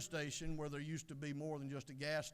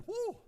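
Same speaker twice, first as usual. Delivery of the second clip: high-pitched, loud whoop; high whew; 6 dB louder than usual. Acted. Attendant reacts to hearing Alabama, a high whew.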